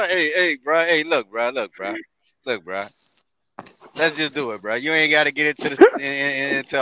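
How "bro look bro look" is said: normal talking voice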